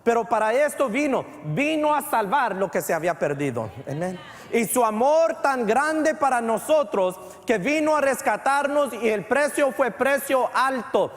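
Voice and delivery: rising pulpit fervor about the sacrifice; fervent preaching. Acted. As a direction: preach it with full conviction. Preaching at full force.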